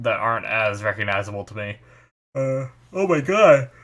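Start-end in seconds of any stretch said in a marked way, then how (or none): 2.3-3.7 talking through a yawn. Real, not acted. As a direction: yawn mid-sentence. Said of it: "Why am I yawning?".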